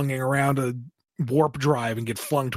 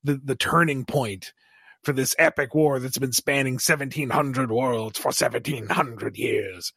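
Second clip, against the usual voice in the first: normal talking voice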